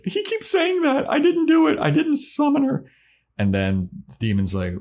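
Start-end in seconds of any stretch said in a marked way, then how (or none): 0.0-2.8 put-on much higher, louder panicked girl voice; shrieking Dawn. Dawn insisting to the gang she summoned nothing, high squeal. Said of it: "Dawn's like".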